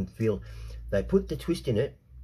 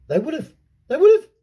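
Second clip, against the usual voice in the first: second take shoots up into a high, loud yell; building shout; 13 dB louder than usual. Real, not acted. Insisting to the crowd, building to a shrill shout. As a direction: hammer it home, louder each time.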